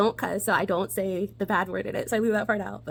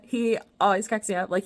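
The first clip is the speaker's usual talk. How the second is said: ordinary talk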